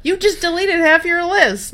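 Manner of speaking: high, slow mock scolding with laughter; laughing retort. Guest answering the list lady in hindsight, laughing retort. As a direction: draw out the mock complaint while laughing.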